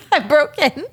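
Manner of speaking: high, slow words through laughter; giggling too hard to talk; laughing helplessly. Real, not acted. Overcome laughing at failed accent.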